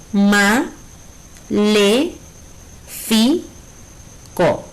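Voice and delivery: MA, LE, FI, CO with long pauses between; teacher splitting maléfico into separate beats; syllable by syllable. Shown as a demonstration. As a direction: dole out ma, le, fi, co one at a time. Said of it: "Si la separamos en sílabas".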